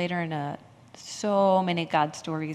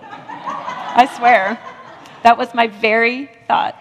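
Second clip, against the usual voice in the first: laughing through it, louder; 3 semitones higher, 10 dB louder, slower than usual. Real, not acted. Telling the congregation her honest thought, laughing. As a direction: swear it while laughing.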